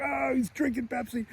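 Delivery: put-on high, softened kid's dismay; Kevin. Kevin dreads bedwetter drinking Pepsi, high mock dismay. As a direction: imitate a dismayed little boy, voice way up. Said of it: "He's like".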